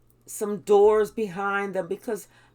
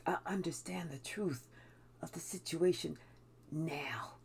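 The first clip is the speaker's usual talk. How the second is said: much softer, fading to a whisper; hushed realization; 6 semitones lower, 13 dB quieter than usual. Retold or imitated. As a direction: breathe it out as the truth dawns. Realizing the truth in a story.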